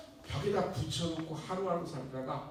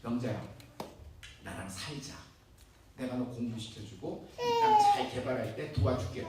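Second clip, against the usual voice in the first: coaxing; 6 semitones lower than usual. Acted. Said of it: "회유하기 시작합니다". (낮고 부드럽게 달래며 회유하는 목소리)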